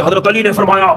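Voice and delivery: mock shouted sermon line; strained, mock-bellowed proclamation; shouting preacher. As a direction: imitate a cleric yelling from the pulpit. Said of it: "وہ بہت چیخ کے بول رہے تھے".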